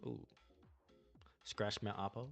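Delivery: ordinary talk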